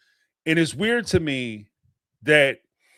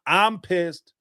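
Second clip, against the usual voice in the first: ordinary delivery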